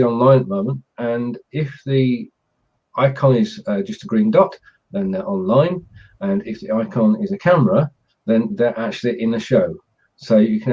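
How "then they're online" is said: normal talking voice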